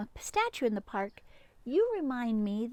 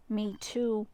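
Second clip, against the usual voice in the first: plain reading voice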